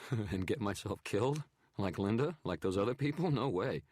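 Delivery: scoffing loud refusal, afraid; bitter scared photographer. A narrator's reading. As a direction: laugh it off in fear. Narrator voices photographer.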